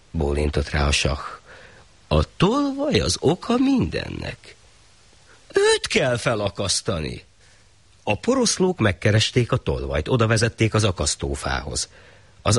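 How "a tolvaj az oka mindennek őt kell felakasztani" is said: high, slow royal verdict; slow high decree; shah decreeing. Acted. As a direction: pronounce it like a pompous ruler. Shah pronounces judgement.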